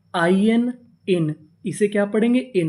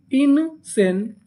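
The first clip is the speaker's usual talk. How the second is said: "इन सेन" very slow in two parts, raised pitch; syllable by syllable; 4 semitones higher, much slower than usual. Shown as a demonstration. Teacher builds the word for learners, "इन सेन" slow and separated. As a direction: draw out "इन सेन" with a gap between. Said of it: "इतनी meaning क्या बनी".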